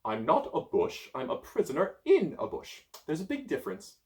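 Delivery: high, huffy correction; indignant wizard. Acted. Merlin correcting the child, high and huffy. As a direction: insist on the difference.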